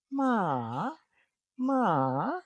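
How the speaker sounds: long "ma" starting high, dipping, swooping back high; rising tone. Shown as a demonstration. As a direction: scoop "ma" down and up. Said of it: "is a rising tone".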